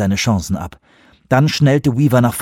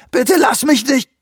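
high, panicked begging cry; desperate plea. A narrator's reading. Rubin begs Weaver as the flood takes him, desperate plea. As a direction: plead desperately, cut short.

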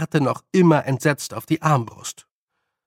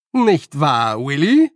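raised voice, rising on the name; louder repeat; 6 dB louder, slower than usual. A narrator's reading. Nanny repeats her question to old man, louder. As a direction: press for agreement loudly. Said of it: "wiederholte Nanny noch lauter".

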